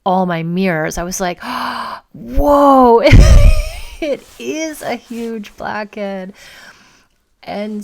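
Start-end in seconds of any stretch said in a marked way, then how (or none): 1.5-2.1 sudden gasp of shock; startled intake of breath; sharp gasp. Retold or imitated. Sees blackhead in mirror. 2.1-6.3 loud high whoa, then slow awed words; shocked laugh at the mirror; amazed whoa. Retold or imitated. Sees blackhead in mirror.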